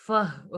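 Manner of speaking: फह with a strongly breathed h at the close; prominent final h. Shown as a demonstration. Teacher stresses the ha sound for students, prominent final h. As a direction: let the h of फह be clearly heard. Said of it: "prominent करना है".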